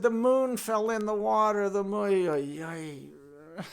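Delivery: mock crying. Chelm villagers lament the moon in the well, mock crying. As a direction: wail loudly, then sink into moaning. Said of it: "and they're crying".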